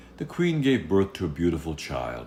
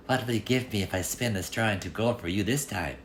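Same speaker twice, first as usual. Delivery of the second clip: lightly voiced little man; 5 semitones higher than usual. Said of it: "he asked".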